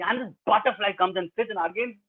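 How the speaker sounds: ordinary delivery, nothing marked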